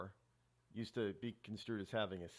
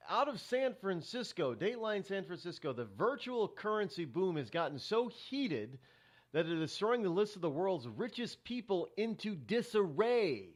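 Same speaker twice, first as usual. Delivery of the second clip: news read aloud; 6 semitones higher than usual. Real, not acted.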